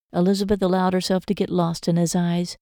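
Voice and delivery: plain reading voice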